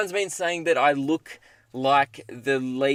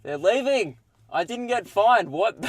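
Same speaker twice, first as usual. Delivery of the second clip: higher, delighted burst of relief; relieved excitement; 7 semitones higher, 3 dB louder than usual. Real, not acted. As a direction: blurt it out with giddy relief. Cops drive off, no fine.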